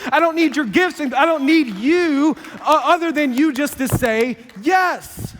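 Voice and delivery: God answering our excuses, loud and certain; God preaching. Acted. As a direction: boom it out like an indignant God. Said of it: "God's like".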